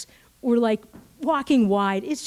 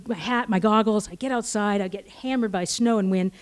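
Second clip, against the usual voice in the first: ordinary delivery, nothing marked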